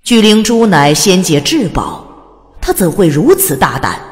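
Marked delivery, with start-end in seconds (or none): none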